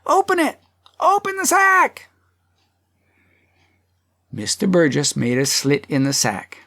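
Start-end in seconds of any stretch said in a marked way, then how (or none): 0.0-2.1 very high crowd cries; crowd clamoring. A narrator's reading. Many voices demand the sack be opened, high cries. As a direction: call out excitedly from the crowd, pitched high. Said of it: "Many voices".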